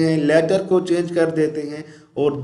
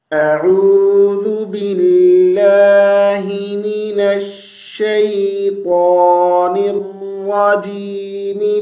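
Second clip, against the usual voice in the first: slow, drawn-out melodic tilawat; Quran recitation; 2 semitones higher, much slower than usual. Acted. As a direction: recite slowly with a long melodic line. (آہستہ، کھینچ کر، سریلی تلاوت)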